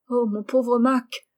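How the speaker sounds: slow, lightly tearful lament; a touch of weeping compassion; tearful pity. A narrator's reading. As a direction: draw it out with aching sympathy. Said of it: "ajouta-t-elle en pleurant".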